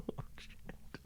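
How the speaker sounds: breathy half-laughing whisper under the breath; whispered aside. Real, not acted. Reader cracking up at the diary, whispering oh shit. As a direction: let it slip out as a voiceless giggle.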